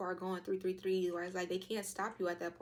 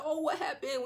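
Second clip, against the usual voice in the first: higher, cooing mock concern; lightly acted worried owner; concerned pet owner; 8 semitones higher, 5 dB louder than usual. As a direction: fuss over a limping dog. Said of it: "owner will take care of them".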